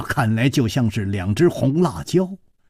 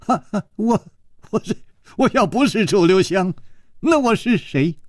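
higher, rueful laughing protest; a wry chuckle of protest; 6 semitones higher than usual. Acted. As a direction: laugh helplessly at not being believed. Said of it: "楚留香苦笑着说".

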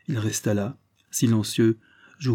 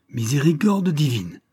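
an exasperated exclamation to heaven; commander's shocked outburst; outcry; 6 semitones higher, 4 dB louder than usual. A narrator's reading. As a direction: cry out, higher and louder. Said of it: "m'écriai-je".

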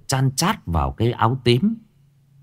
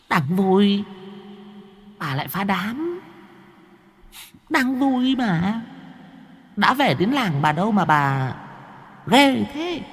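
high, thin, sulky ghost voice, pouting; a petulant spirit whining at being spoiled; whiny sulking ghost; 10 semitones higher, 3 dB quieter, slower than usual. A narrator's reading. Purple-shirt ghost complaining to the old woman, high sulky whine. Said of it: "nheo nhéo cái giọng ra điều giận dỗi lắm".

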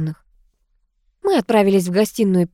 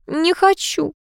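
high, plaintive whine; whining; 9 semitones higher, slower than usual. A narrator's reading. Niece refusing to look in the mirror, whining. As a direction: whine it out, pitched well up. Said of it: "заныла та".

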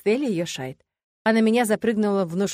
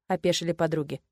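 ordinary delivery, nothing marked

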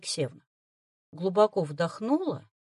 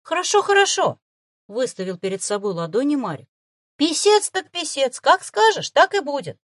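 high, louder hurried appeasing; placating; 6 semitones higher, 6 dB louder than usual. Acted. Boy hastily gives in to the fox. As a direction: hands up, give in.

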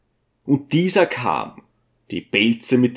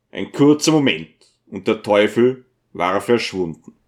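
ordinary delivery, nothing marked

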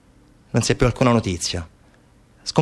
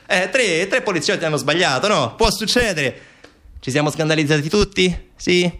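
high-pitched mock shrug-off; sarcastic excuse; 6 semitones higher than usual. Officials minimise the scandal, sarcastic. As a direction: play down the fault in a higher, ironic voice.

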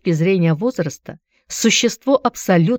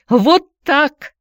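much higher, louder cry; shrill outburst; 10 semitones higher, 5 dB louder than usual. A narrator's reading. Antonina to ex-husband, shrill helpless outburst. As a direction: fling it out shrilly. Repeated exclamation.

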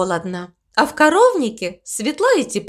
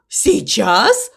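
puzzled question with a rising end; surprised question. A narrator's reading. Crow echoing the cow's announcement, surprised question. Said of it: "удивился ворон".

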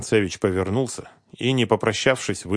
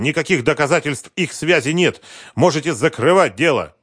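loud, higher-pitched defiance; defiant husband; 8 semitones higher, 7 dB louder than usual. A narrator's reading. Husband telling the investigator to close the case, defiant. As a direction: declare it finished, forcefully.

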